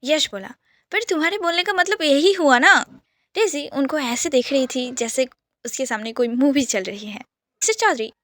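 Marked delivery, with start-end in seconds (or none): 0.9-2.7 louder and higher, pressing the point; accusing challenge. Acted. Yash cornering Arnav in the quarrel, loud and accusing. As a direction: push him to admit it.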